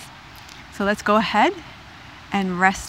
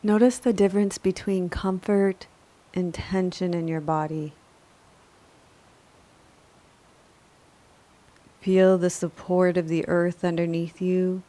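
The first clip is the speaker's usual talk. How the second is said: calm, measured yoga nidra guidance; unhurried, even-toned relaxation voice; guided meditation; 2 semitones lower, 7 dB quieter than usual. Acted. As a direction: guide a resting listener gently, with long pauses between phrases.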